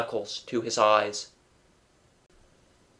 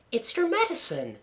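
high, soft woman's voice; Missus Darling's reproach; 13 semitones higher, 5 dB quieter than usual. A narrator's reading. Wife smelt the bowl, high soft dismay. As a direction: reproach him softly, pitched way up. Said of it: "she said".

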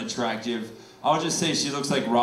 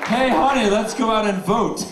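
put-on higher, louder grumpy old husband; grumpy husband yelling; 8 semitones higher, 4 dB louder than usual. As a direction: yell it at your wife, annoyed. Acted husband yelling at wife.

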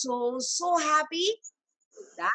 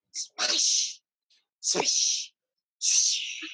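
axe swish sound; much slower than usual. Pretend. Axe strokes sound effect.

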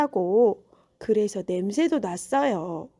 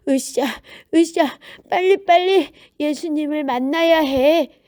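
eager effort; 5 semitones higher, 4 dB louder than usual. A narrator's reading. Beggar hurries eagerly to meet Jesus.

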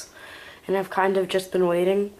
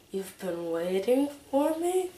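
normal talking voice

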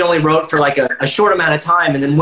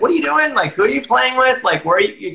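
a hint of mom, higher-pitched questioning; lightly voiced mom; 7 semitones higher than usual. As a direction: lightly imitate a mom's higher, prying questions. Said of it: "my mom was like".

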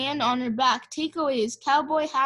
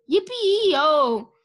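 loud, drawn-out holler; cowboy whoop; 3 semitones higher, 6 dB louder than usual. A narrator's reading. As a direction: call out like a riding cowboy. Cowboy's shout heard from outside.

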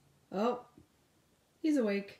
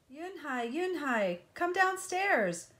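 calling up the stairs; 8 semitones higher than usual. Acted. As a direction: call her down from another floor. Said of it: "Mother called up".

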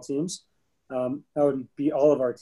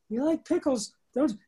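squeaky, much higher little voice; 10 semitones higher than usual. Retold or imitated. Man retelling meeting his wife, mimicking her tiny high voice. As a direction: imitate her tiny, high-pitched teasing question. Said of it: "She said".

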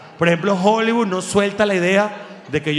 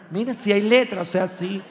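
ordinary talk, nothing marked

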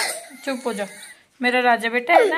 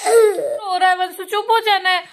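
high, raised singsong coaxing; motherly coaxing; 6 semitones higher, 5 dB louder than usual. Real, not acted. Mother coaxes crying son to hush, high raised voice.

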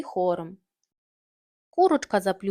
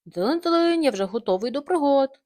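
cheerful tractor; 5 semitones higher, 5 dB louder than usual. Acted.

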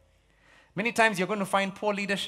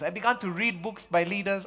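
ordinary talk, nothing marked

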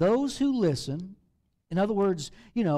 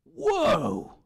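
a hammed-up "Whoa!" at the mirror; jokey fake shock at the mirror; mock startled gasp; 3 dB louder than usual. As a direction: act startled by your own face. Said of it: "and goes".

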